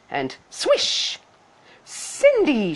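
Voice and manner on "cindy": high, lilting call; high-pitched summons; fairy crying out. A narrator's reading. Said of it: "she cried".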